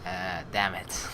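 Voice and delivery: low, slow letdown; disappointed. Real, not acted. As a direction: sink into disappointment. Disappointed reaction.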